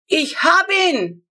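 high, loud, slow gloating cry; triumphant. A narrator's reading. Neighbour gloating over the animal he shot, triumphant. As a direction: crow over the kill. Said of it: "hört man Unwisch triumphieren".

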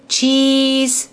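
put-on girl chirping for the camera; saying cheese. A narrator's reading. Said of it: "sagte Clara".

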